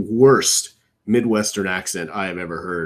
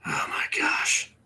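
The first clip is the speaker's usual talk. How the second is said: slow, soft, unvoiced oh my gosh; whispered disbelief; 8 dB quieter, slower than usual. Real, not acted. A streamer, watching the broadcast, whispers in disbelief. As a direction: let it slip out as a pained whisper. Reacting to the show on screen.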